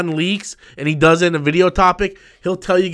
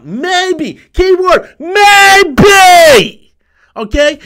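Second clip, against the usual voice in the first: shouts MAYBE much higher and louder; furious shout; 10 semitones higher, 8 dB louder, much slower than usual. Real, not acted. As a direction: scream the keyword maybe at him. Stressing keyword maybe at troll.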